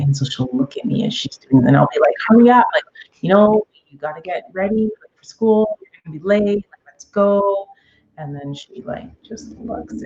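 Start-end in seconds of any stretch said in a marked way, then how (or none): 2.3-7.7 raised, urgent nagging; impatient parent. Acted. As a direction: hurry the child along, pitched up. Mother hurrying dawdling daughter, acted.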